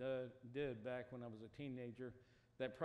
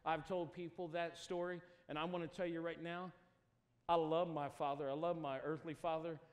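loud, high, impassioned preaching; preaching at full force; 5 semitones higher, 9 dB louder than usual. Acted. Preacher urging the congregation toward freedom in Jesus. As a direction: lift it to a rousing altar call.

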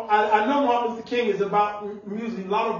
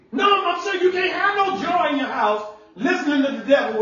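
raised, higher preaching shout; preaching at full force; 6 semitones higher than usual. Acted. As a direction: drive the warning home to the congregation. Preacher pushing the point.

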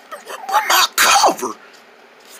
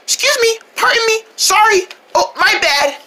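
high polite voice; 12 semitones higher than usual. Acted. Sneaking past, high polite voice.

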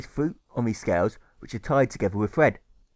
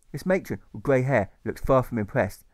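usual speaking voice

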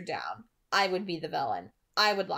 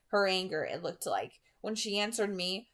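ordinary delivery

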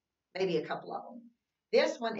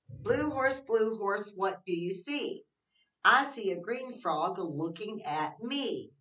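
sing-song read-aloud, slow and even; reading to children; 3 semitones higher, 3 dB louder, slower than usual. Acted. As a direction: read the rhyme to little kids, slowly. Reads picture book.

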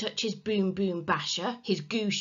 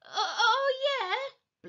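high, stumbling bluster; much slower than usual. A narrator's reading. Henry caught in Peter's room, blustering. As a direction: stall with hollow defiance. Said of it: "blustered Henry".

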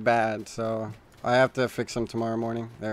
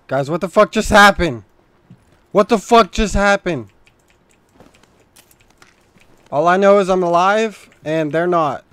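loud, higher disbelieving yell; real amazed shouting; stunned outburst; 4 semitones higher, 10 dB louder than usual. Real, not acted. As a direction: blurt it out in shock at the team. Stunned after surprise firefight.

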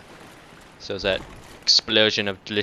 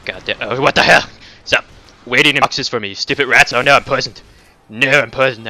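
loud, higher, real furious shout; angry yelling; 3 semitones higher, 10 dB louder than usual. Real, not acted. Gamer ambushed again and poisoned, loud furious yelling. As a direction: yell at the rats, then at the poison.

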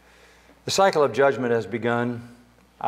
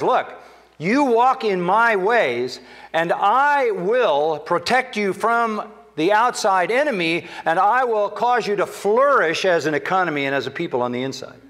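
preaching God's promise; 8 semitones higher than usual. Acted. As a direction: proclaim God's promise with lift.

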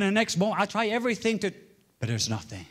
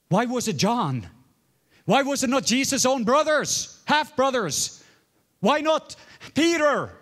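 higher, louder rising preacher's questions; preaching at full force; 10 semitones higher, 4 dB louder, slower than usual. Acted. Preacher to congregation, questions building loud.

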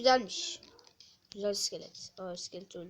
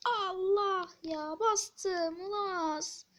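dismayed groan; 9 semitones higher, much slower than usual. Real, not acted. Player dismayed by misstep.

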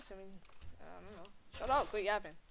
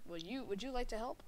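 normal talking voice

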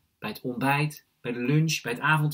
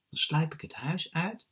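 ordinary delivery

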